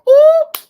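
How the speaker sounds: high, loud delighted ooh; delighted squeal. Real, not acted. Vlogger squealing at a chat comment, delighted.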